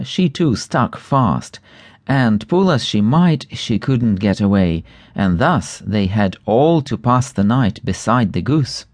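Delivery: plain reading voice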